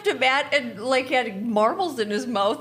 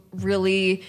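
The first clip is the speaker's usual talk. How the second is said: ordinary delivery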